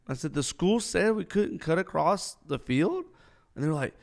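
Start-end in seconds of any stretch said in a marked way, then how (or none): 0.3-3.1 incredulous. Retold or imitated. Incredulous question to cadets.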